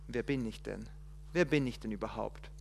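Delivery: soft, low self-questioning; half to himself, unsure; doubting Moses. Acted. Moses doubting his worth before God, quiet.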